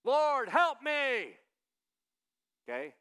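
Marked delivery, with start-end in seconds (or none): 0.0-1.4 loud, drawn-out cry for help, pitched high; shouting plea. Acted. Woman begging Jesus for help, shouting. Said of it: "she's shouting".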